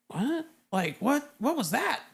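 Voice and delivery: imitating baffled sailors; confused. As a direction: ask in startled confusion. Sailors hearing second impact.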